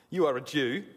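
chuckling through the corrected words; trying to read on through a real laugh; amused. Real, not acted. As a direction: break into a laugh after a slip. Laughs at own misreading of verse.

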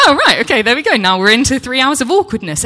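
loud, high, flustered reaction; awkward resignation. Retold or imitated. Traveller facing three hours of awkwardness, loud and flustered. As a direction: blurt it out loudly in mock resignation. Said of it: "it was really awkward".

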